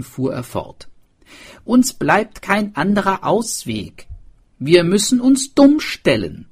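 high, loud character voice; swineherd. A narrator's reading. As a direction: argue the plan with conviction. Swineherd explains the plan.

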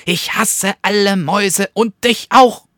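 high, loud, fast threat; threatening weasel. A narrator's reading. Second weasel threatening to eat the bat, high loud and fast. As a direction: bark it at your prey.

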